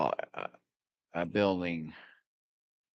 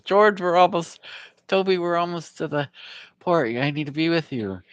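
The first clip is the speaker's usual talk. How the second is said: calling out; 12 semitones higher, 6 dB louder than usual. Retold or imitated. Boy calling ahead to friend.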